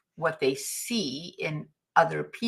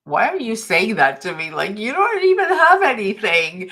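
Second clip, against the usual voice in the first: indignant thought; 4 semitones higher, 6 dB louder than usual. Retold or imitated. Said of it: "And I thought".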